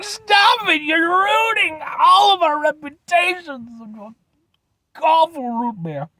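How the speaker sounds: shouted, high mock reprimand trailing off; mock scolding. Viewers telling Jolly Good people to stop, mock high shout. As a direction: scold the brand playfully with a yell. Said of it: "Be like".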